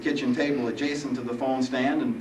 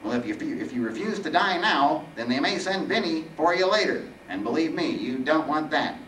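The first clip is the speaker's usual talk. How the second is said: higher, whiny nasal threat; warning Reaper; 7 semitones higher, 4 dB louder than usual. Acted. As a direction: warn him as the weeny Reaper.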